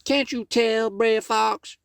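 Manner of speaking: pitched high and playful; high teasing rabbit voice. Acted. Brer Rabbit teasing Brer Fox from the chimney, high and playful.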